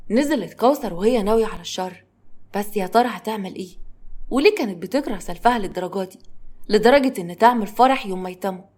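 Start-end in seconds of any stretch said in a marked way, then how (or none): none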